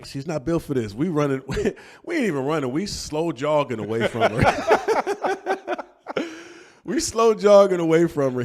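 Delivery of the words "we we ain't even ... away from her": cracking up while talking, high and loud; real laughter. Real, not acted. Dad telling friend of the getaway, laughing hard. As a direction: laugh through the words as you tell it.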